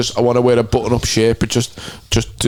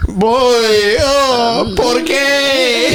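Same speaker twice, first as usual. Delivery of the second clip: mock pleading; 9 semitones higher, much slower than usual. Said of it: "And he's going".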